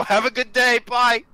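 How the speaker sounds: chirpy sign-off while flipping them off; sarcastically bright goodbye; cheeky goodbye. Acted. Cheeky farewell to boy and guard.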